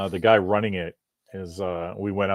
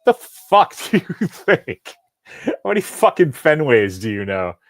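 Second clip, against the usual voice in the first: imagined rude retort; 6 semitones higher, 7 dB louder than usual. Retold or imitated. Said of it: "I didn't go".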